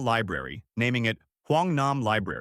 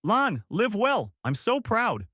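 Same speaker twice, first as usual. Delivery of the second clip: high, slow, heartfelt call; yelling; 10 semitones higher, slower than usual. A narrator's reading. Nam cheering Lan on in a dream, high yell. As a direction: call out high and stretch the words. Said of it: "I yelled".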